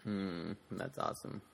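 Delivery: low, quiet, half-swallowed words; mid-sip of water. Real, not acted. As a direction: murmur it while swallowing water. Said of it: "I was taking a sip of water".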